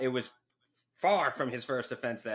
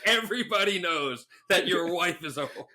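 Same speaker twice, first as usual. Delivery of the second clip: higher and louder taunt, laughing; mocking chirp; 5 semitones higher, 4 dB louder than usual. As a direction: taunt like a trash-talking player. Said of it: "He's out there going".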